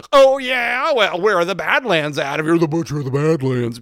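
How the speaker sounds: a cocky crook throwing it back loud and high; high mocking challenge. The booze dealer taunting the so-called butcher, loud high sneer.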